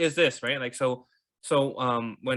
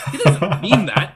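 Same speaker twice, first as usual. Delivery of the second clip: loud, higher, broken by laughter; laughing aside; 5 semitones higher, 11 dB louder, slower than usual. Real, not acted. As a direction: laugh your way through it. Laughs at his own self-criticism.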